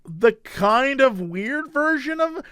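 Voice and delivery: normal talking voice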